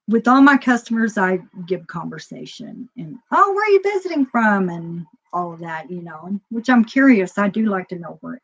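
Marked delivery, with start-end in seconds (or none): 3.3-4.7 high-pitched shopkeeper's small talk; chatty customer greeting. Retold or imitated. Shopkeeper making conversation with a customer, high-pitched greeting.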